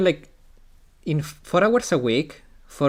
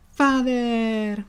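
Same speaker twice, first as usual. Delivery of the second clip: put-on girl's voice; 8 semitones higher, 3 dB louder than usual. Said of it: "I have no talent for".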